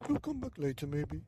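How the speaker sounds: put-on high, easy old man voice; old man reassuring. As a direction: brush it off, high and light.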